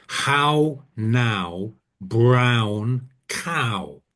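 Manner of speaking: drawn-out "How Now Brown Cow", each word separate; elocution exercise. Shown as a demonstration. Speaker naming a technique after an old elocution lesson, "How Now Brown Cow" drawn out. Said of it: "used in elocution lessons to get you to speak".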